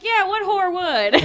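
high, slow mocking sneer; sarcastic. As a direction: sneer it with fake innocence. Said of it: "I would've been like".

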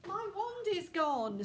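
put-on a girl panicking about her missing wand; alarmed cry. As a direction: wail it out like the wand is truly lost. Hermione reports her lost wand.

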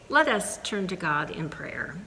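usual speaking voice

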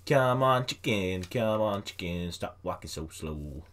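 ordinary talk, nothing marked

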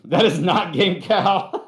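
laughing. Real, not acted. Joking about cow mask, laughing.

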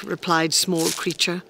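ordinary delivery, nothing marked

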